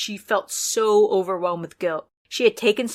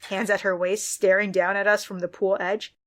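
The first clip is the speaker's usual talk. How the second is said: usual speaking voice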